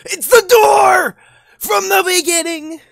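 high, drawn-out yell of recognition; excited shout. Acted. Reacting to door in game.

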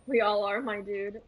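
usual speaking voice

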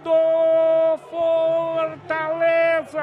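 long stretched goal cry; drawn-out goal call. Real, not acted.

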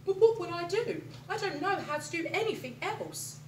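worried. Acted. Alan fears a life without scaring, worried plea. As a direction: plead helplessly.

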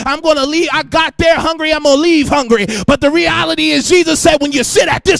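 loud, ringing sermon cadence; preaching at full force. Acted. Preacher calling out to the congregation in full force. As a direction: preach it with rising fire.

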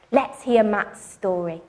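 ordinary delivery

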